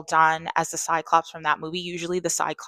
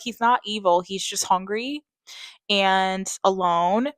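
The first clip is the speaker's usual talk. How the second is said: ordinary talk, nothing marked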